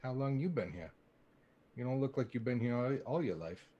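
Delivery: ordinary talk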